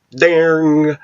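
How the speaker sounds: high, sharp "ding" sound effect; imitated boing of the shorts; mock ding. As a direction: pop the word out like a cartoon spring.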